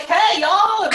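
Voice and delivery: high, playful Southern drawl; Southern accent. Shown as a demonstration. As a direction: holler a mock Southern greeting, close to laughing. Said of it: "the Southern accent doesn't work".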